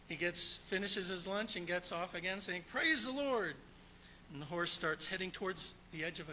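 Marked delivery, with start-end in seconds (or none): 2.7-3.6 mock preacher call. Preacher commanding his horse.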